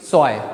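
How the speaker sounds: स्वाय as "tsvai", ending like English "I"; German pronunciation. Shown as a demonstration. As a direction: model स्वाय with an "ai" glide. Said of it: "कैसे आवाज़ निकलेगी इसकी".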